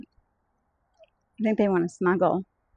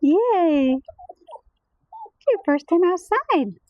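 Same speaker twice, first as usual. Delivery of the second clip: high, slow praise to a puppy; 8 semitones higher, much slower than usual. Real, not acted.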